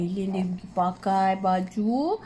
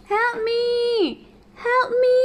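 calling for help; 11 semitones higher than usual. Acted. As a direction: shout up for help, stuck in a tree. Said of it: "Dia minta tolong".

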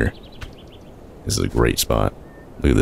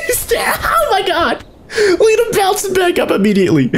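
very high, squealing through laughter; laughing shriek. Real, not acted. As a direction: shriek with delight. Cracking up at bouncing gardener.

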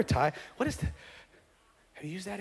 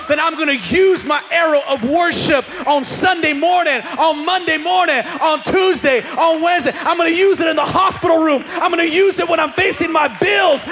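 louder, higher shouted vow; full-force preaching; 10 semitones higher, 10 dB louder than usual. Real, not acted. Preacher rallying congregation with shouted vows of victory. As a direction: preach it with rising fire.

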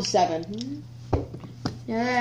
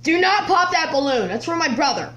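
angry; 6 semitones higher, 4 dB louder than usual. Real, not acted. Stopping brother popping balloon.

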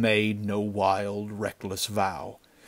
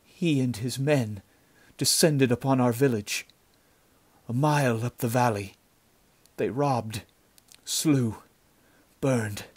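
put-on higher, faltering voice of a wounded girl; fading strength, words in short broken bursts; growing weaker; 4 semitones higher than usual. A narrator's reading. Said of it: "her voice swiftly growing weaker".

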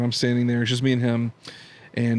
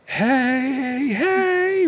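drawn-out, high-pitched mock yell; imitated child's yell; 13 semitones higher than usual. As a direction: holler like a little kid, much higher than usual. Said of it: "Judah yells out".

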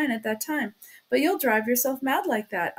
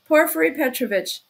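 raised, higher, carefully articulated address; loud and distinct; 5 semitones higher, 6 dB louder than usual. A narrator's reading. Suspect confronting the investigator, loud and distinct. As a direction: call the name out firmly and clearly. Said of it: "speaking loudly and distinctly".

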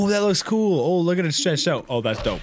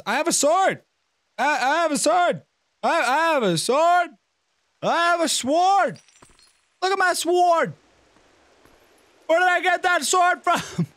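higher, thrilled exclaiming; real excitement, higher pitch; excited; 12 semitones higher than usual. Real, not acted. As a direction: show off the sword with glee. Excited repeated shouts.

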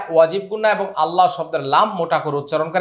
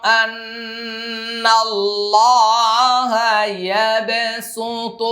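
slow, drawn-out sung tilawat; melodic Quran recitation; 4 semitones higher, much slower than usual. Shown as a demonstration. Teacher recites the verse to students in slow tilawat. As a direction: stretch each word in a high, chanting line.

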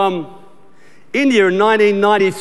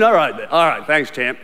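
put-on broad Australian accent; 7 semitones lower than usual. Pastor demonstrates his Australian greeting for Americans, low broad accent. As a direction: lay the Australian accent on thick. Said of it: "playing the Aussie".

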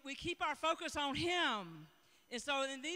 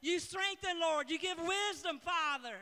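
loud, rhythmic prayer with Lord at each line end; fervent prayer; 2 semitones higher, 4 dB louder than usual. Acted. Pastor praying over the nation with listeners, fervent prayer. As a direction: pray it out with fervour.

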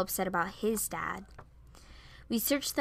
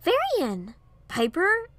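high, louder cry of surprise; startled call; 11 semitones higher, 6 dB louder, slower than usual. A narrator's reading. Varian and Piper stumble on each other, startled. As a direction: call the name out, then question it higher.

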